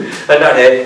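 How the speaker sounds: ordinary talk, nothing marked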